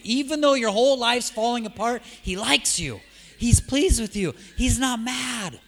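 rising pulpit cry that slows at the end; preaching climax. Acted. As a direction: preach it out, every word pushed hard.

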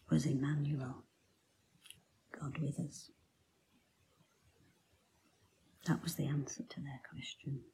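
soft hushed revelation; preacher whispering the answer to the congregation; reverent whisper. Acted. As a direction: whisper it like a sacred secret. Hushed revelation of who Jesus is.

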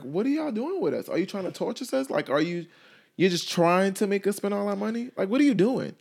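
high, rising complaint of an exasperated fan; mock exasperated fan. Fan complains to Disney about missing Cinderella, mock exasperated whine. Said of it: "It's like".

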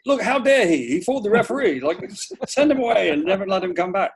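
hammed-up British outrage at a cheat; outraged Brit. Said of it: "in Britain it would be like".

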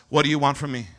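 low, softened question; calm, low voice; Jesus. Acted. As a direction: ask it gently. Jesus speaks to the blind man.

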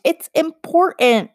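high, slow insistent plea; high pleading. Acted. Girl pleading with the witch, high and slow. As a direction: push it up in timid urgency.

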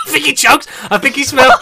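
loud laughing through the words; cracking up mid-sentence. Real, not acted. Laughing after choking fit.

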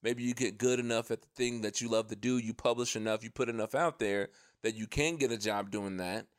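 normal talking voice

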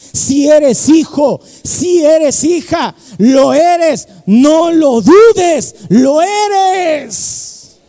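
slow, loud, rising reassurance; preaching assurance. Acted. Preacher assuring the congregation they are God's children, slow and loud. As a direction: press each word home to the doubting believer.

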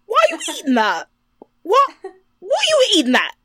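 high, loud, fast imitation of a South London friend; mock British accent. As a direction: squawk it like a shocked Londoner. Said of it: "And Alicia's like".

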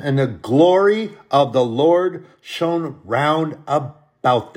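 preacher lifting the Christmas verse, loud; scripture reading. Acted. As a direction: read the angel's words with swelling awe. Rereads scripture aloud, raised voice.

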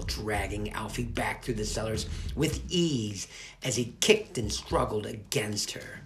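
usual speaking voice

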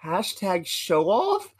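slow, puzzled rising repeat; incredulous echo. Retold or imitated. Narrator retelling echoing his friend, baffled slow question. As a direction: repeat it in disbelief. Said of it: "I just look at him like".

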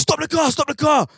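high, loud stop the car; urgent shout. Retold or imitated. Friend yells at the driver to stop. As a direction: yell it in a panic. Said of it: "he just goes".

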